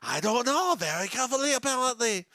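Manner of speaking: high mock French accent; put-on French accent. Host answers co-host's hat question, high French accent. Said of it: "We're doing this the rest of the episode".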